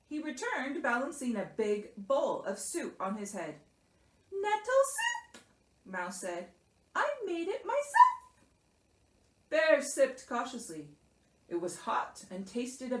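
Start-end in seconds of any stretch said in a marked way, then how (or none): 4.3-5.4 very high little mouse voice; helpful Mouse. A narrator's reading. Mouse serves soup. 6.9-8.4 very high, squeaky pride; proud Mouse. A narrator's reading.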